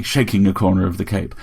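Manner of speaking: ordinary delivery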